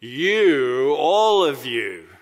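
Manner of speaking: "You" called out loud and drawn out; booming "You, all of you"; emphatic call. Retold or imitated. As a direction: call "all of you" out slowly to the whole room. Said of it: "And he's saying".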